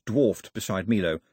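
plain reading voice

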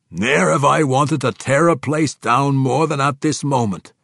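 high, loud dwarvish declaration; fierce dwarf king. Acted. Dwarf king eager to tear the place down, loud and fierce. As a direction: declare it forcefully as a dwarf warrior.

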